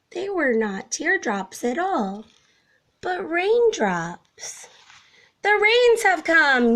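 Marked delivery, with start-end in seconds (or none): 5.4-6.7 high, loud excited yell; storybook yell. A narrator's reading. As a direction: termites shout the news to everyone. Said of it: "yelled the termites".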